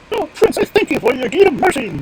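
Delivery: high, loud squeaky bird voice; high-pitched earnest bird; grateful stork. Acted. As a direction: pipe your thanks as a stork. Grateful stork gives thanks.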